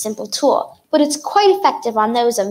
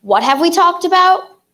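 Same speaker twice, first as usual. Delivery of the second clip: louder demand; 6 semitones higher, 4 dB louder than usual. Acted. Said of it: "I didn't hear you".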